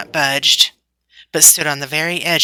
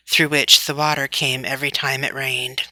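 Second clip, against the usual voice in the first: ordinary delivery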